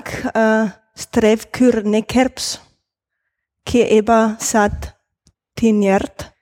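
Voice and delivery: Strevkür Nekerps, words sounded out slowly in reverse; spoken backwards. Shown as a demonstration. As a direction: Keeba Sat Tinjert, slow reversed speech. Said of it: "Ich kann rückwärts sprechen".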